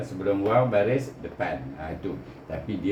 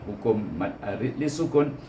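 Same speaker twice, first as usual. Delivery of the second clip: ordinary talk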